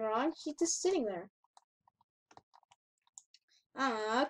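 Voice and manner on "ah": ordinary talk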